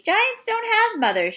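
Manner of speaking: high, disbelieving exclamation; as if she said something absurd; incredulous. Acted.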